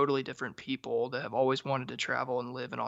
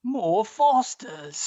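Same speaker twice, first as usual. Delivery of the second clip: put-on Australian accent; 7 semitones higher, 7 dB louder, much slower than usual. Joining the Aussie bit.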